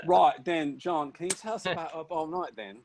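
high, hammed-up British interviewer; British impression. Interviewer asking about a tune, in hammy British accent. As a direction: put on a mock British accent, pitched high. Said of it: "some sort of British impression".

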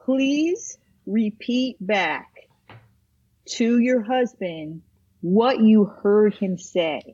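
slow, deliberate therapist voice. Acted. Therapist coaching the wife to repeat back, slow and deliberate. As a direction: spell it out slowly and evenly. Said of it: "the therapist would say to the".

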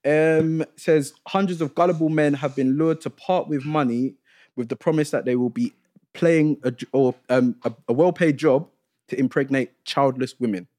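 big, drawn-out show-opener into a news read; lifted, punchy news-read delivery; news announcer. Acted. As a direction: present the headline like a news host. Presents the show's news segment.

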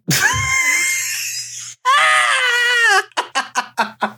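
shrieking laugh. Real, not acted. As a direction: burst out laughing high. Cracking up at her own story.

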